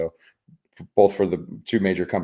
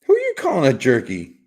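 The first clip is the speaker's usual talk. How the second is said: higher, louder mock outrage; mock indignation; 5 semitones higher, 7 dB louder than usual. Streamer to chatter over a typo, playfully indignant. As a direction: act offended for fun, loudly.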